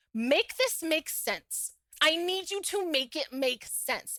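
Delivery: slow, each word spaced; slow demanding. Retold or imitated. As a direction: drag it out, demanding. Demanding he explain.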